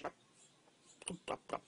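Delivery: soft imitation of turkey gobbling; hushed little turkey call; turkey gobble. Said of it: "and the turkey says".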